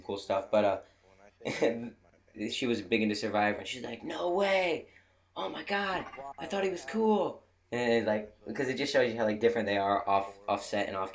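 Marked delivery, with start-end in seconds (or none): 4.0-7.4 excited fan gasp. Retold or imitated. Fan gushing about Survivor gossip.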